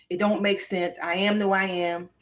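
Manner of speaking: ordinary talk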